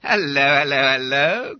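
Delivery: loud cheerful greeting; cheery call. A narrator's reading. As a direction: call out heartily. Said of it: "cried Bob Cherry".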